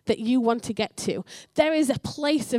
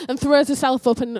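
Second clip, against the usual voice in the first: ordinary delivery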